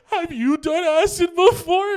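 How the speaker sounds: high, loud, tearful wail; hammed-up crying question; mock crying. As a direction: wail it like a panicking, weepy tripper. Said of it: "started crying".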